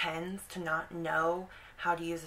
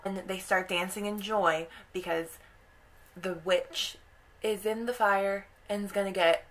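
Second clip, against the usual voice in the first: ordinary talk, nothing marked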